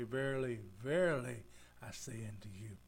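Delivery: "Verily, verily" each with a falling, weighty stress; emphatic verily. Shown as a demonstration. Pastor showing how Jesus stressed his point, weighty fall. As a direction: lean hard on "Verily, verily". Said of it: "When he really wanted to emphasize something".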